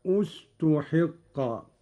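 "استحق" begun on an u vowel, ustuḥiqqa, very slowly; starting with damma. Shown as a demonstration. Teacher shows how to begin, "استحق" as slow ustuḥiqqa. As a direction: start "استحق" with a clear u, drawn out. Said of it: "البدء بضم الهمزة".

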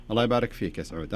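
ordinary talk

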